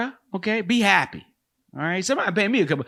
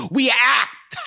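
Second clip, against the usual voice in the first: hammed-up actor; 8 semitones higher, 4 dB louder than usual. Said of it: "This is what we do".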